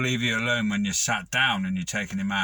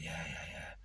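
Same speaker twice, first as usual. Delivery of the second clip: feeble sick murmur; 8 semitones lower, 18 dB quieter than usual. Pretend. Feeble sick reply to boss.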